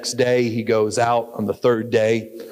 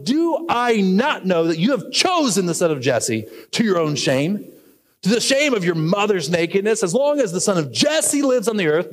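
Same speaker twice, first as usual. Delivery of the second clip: furious king; 7 semitones higher than usual. Acted. Preacher voices furious King Saul.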